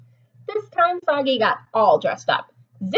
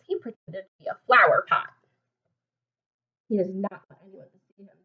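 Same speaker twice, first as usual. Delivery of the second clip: usual speaking voice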